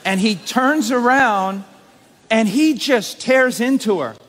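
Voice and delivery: full-force preaching. Acted. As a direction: preach it to the rafters, building to joy. Preacher building the story.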